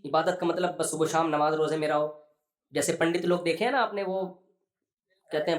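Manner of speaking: ordinary talk, nothing marked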